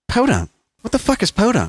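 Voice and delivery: high-pitched, incredulous imitation of a girlfriend; baffled girlfriend. South African girlfriend hearing a new word, high and baffled. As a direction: mimic her bewilderment, pitched well up. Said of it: "it blew me away that she didn't know".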